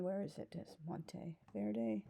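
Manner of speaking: slow whispered aside; breathy, under the breath, slowly. Real, not acted. Searching for pen, whispering aside.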